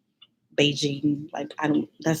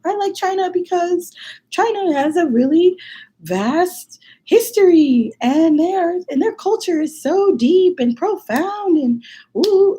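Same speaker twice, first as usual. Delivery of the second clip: much higher, slow, nervous beginner's speech voice; imitating her nervous self; 12 semitones higher, 4 dB louder, slower than usual. To the contest audience, a nervous high-pitched learner's speech. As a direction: squeak it out like a shy first-year student. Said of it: "two minutes of me being like".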